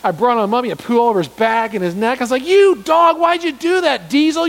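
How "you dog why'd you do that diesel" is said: loud, high yell at Diesel; high, loud exasperated yell at the pet dog. Retold or imitated. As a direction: yell at the dog covered in poo. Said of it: "Why am I yelling at the dog?".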